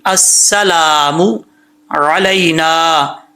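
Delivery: slow अस्सलामु अलैना with clear mu and throaty ain; correct recitation. Shown as a demonstration. Teacher corrects the ending, careful tajweed. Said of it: "मो पढ़ेंगे mistake हो जाएगी".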